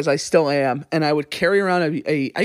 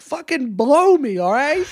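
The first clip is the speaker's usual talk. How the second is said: slow, higher-pitched pretend outburst; comic mock yell; 7 semitones higher, slower than usual. Telling co-host how he answers annoying coworkers, comic mock yell. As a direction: play up the annoyance for laughs. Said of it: "I'll just yell at him".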